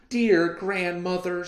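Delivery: sympathetic. Acted. Girl comforting sick grandmother, high sympathetic voice. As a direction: coo it kindly to the sick old lady. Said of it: "with sympathy in her voice".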